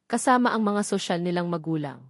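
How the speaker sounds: usual speaking voice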